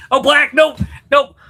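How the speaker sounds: high, loud shocked "nope, nope"; catching the slip, loud and high; surprised correction. Real, not acted. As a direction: shout down your own slip. Catches his own slip.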